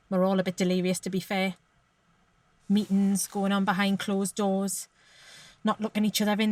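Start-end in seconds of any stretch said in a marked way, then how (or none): none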